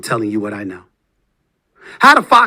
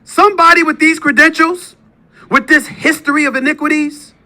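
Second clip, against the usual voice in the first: loud, much higher, outraged; indignant shouting; 11 semitones higher, 4 dB louder than usual. Real, not acted. Host blasting his rival's scandals, outraged shouting. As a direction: hammer each charge louder. Furious list of accusations.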